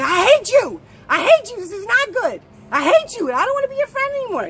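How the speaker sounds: angry yelling. Retold or imitated. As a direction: explode at him like you'll never forgive him.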